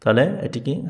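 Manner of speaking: ordinary delivery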